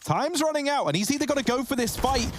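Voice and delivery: high, hyped clutch call; excited play-by-play. Real, not acted. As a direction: build the tension to the win.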